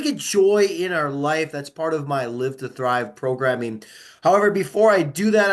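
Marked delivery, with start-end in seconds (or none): none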